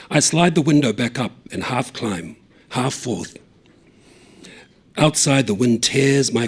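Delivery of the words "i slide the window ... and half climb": plain reading voice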